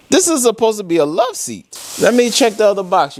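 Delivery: sudden alarm at the missing half; alarmed disbelief. Real, not acted.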